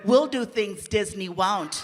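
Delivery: slow, sly pitch voice; a studio exec luring a comic with a slow, sly line. Retold or imitated. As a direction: tempt him like a shady studio exec. Nickelodeon exec voiced slyly.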